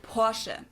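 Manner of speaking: Porsche as German POR-shuh; short final E. Shown as a demonstration. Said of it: "this short E sound in the end".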